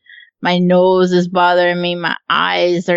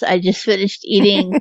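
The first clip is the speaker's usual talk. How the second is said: ordinary delivery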